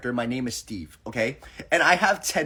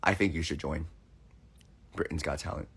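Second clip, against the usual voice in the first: deep flat caller voice; 13 semitones lower, 12 dB quieter than usual. Retold or imitated. Caller urging her to join Britain's Got Talent, low and quiet. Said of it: "And they're like".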